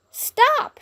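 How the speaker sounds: very high shrieked stop; scream. A narrator's reading. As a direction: scream to halt the chaos. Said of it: "she screamed".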